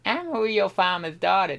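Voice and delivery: rough attempt at an American farm girl accent. Shown as a demonstration. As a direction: put on a Southern American farm girl twang. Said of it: "the American Farm Girl".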